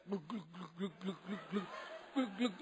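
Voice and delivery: mock glugging sounds, laughing through; playful glug glug; gulping noises. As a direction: imitate him gulping it down. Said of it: "And he's like".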